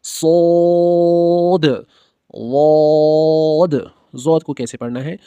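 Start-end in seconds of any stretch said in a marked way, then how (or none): 0.0-3.9 सोद and वोद held long and louder; echoing heavy letters. Shown as a demonstration. Teacher models the bouncing end of सोद. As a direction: let सोद ring out and bounce back. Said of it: "आवाज़ इसकी bounce होती है".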